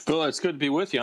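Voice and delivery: ordinary talk, nothing marked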